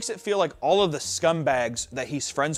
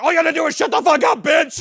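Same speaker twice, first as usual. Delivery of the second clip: shouted, fast and much higher; hammed-up enraged shout at his agent; mock furious yell; 14 semitones higher, 9 dB louder than usual. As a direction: scream it at her in a rage. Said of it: "He's like".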